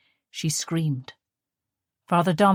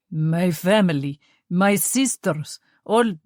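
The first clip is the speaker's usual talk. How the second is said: put-on slow, halting, heavy Russian accent; Russian officer struggling to say his family is dead; struggling for words; 4 dB louder, slower than usual. A narrator's reading. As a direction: grope for each word, pausing. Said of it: "he struggled to find the words".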